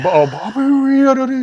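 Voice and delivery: a badly done Bobby Lee voice; imitating comedian Bobby Lee for a friend; bad Bobby Lee impression. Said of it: "So that was so bad".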